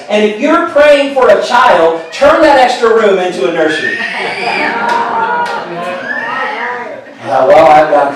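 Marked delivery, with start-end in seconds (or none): 0.1-4.0 higher, punchy preacher delivery; preacher's line. Retold or imitated. Brother preaching to the congregation, higher and emphatic. 4.0-7.2 big laugh. Real, not acted. Stunned by the confirmation.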